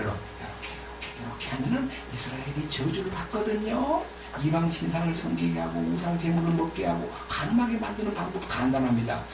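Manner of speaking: hushed, scheming advice in the ear; whispered scheming. Acted. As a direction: lean in and whisper the plot. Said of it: "귓속말로".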